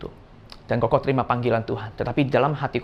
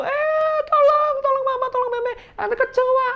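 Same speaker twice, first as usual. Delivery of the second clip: very high, panicked plea; screaming for help. Retold or imitated. Mother and sister begging for rescue from a cockroach, screaming. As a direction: cry out desperately, pitched way up. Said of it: "dia teriakin namamu".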